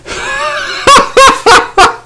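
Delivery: long, very high-pitched laughing fit; high cackle. Real, not acted. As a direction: cackle helplessly in falsetto. Laughs at someone's mistake.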